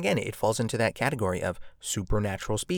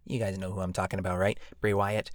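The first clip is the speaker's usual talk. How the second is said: ordinary talk, nothing marked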